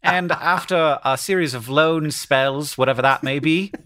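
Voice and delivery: measured, put-on BBC English delivery; BBC English accent. Shown as a demonstration. Reciting a football manager's career, BBC English accent. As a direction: read it out in an affected English newsreader accent. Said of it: "this is, like, B B C voice".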